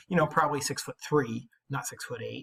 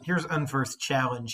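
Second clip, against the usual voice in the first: ordinary talk, nothing marked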